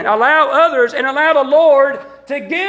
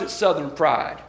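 pride in a Southern US accent, softer; 10 semitones lower, 6 dB quieter than usual. Shown as a demonstration. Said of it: "we say pride with a Southern accent".

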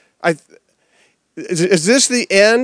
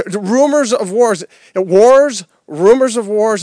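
higher and louder, hammering wars; preacher building to a cry; rising refrain; 4 semitones higher, 4 dB louder than usual. Real, not acted. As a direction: drive the refrain home.

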